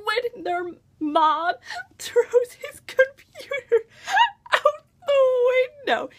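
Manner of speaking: high, squeaky laughter breaking through the words; laughing. Real, not acted. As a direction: crack up while telling it. Cracks up retelling the funny scene.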